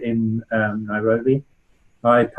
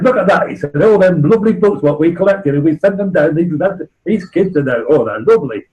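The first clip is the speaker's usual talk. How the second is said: northern bloke; 3 semitones higher than usual. Retold or imitated. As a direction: do the Yorkshire bloke, chuffed and proud. Northern bloke voiced in anecdote.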